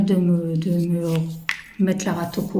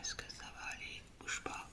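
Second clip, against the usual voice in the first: barely audible whisper while pouring carefully; tense whisper; 26 dB quieter than usual. Real, not acted. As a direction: breathe it so nothing spills. Tense pour, whispers to object.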